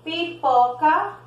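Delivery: pipoca said slowly, both P's crisp; slow and clear. Shown as a demonstration. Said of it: "Quando eu falo, por exemplo".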